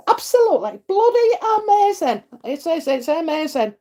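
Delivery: high excited burst; excited gush. Real, not acted. Telling viewers she feels blessed, high and excited. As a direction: gush with delight.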